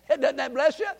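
usual speaking voice

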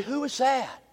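soft, wondering question; hushed wonder. Retold or imitated. Boaz asking his workers about Ruth, hushed. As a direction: ask it under your breath, smitten. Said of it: "I can hear him say".

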